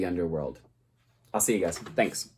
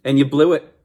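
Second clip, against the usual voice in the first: louder and higher scolding; the teacher scolds Orpheus, louder and higher; exasperated; 6 semitones higher, 8 dB louder than usual. Acted. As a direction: tell him off with emphasis on blew.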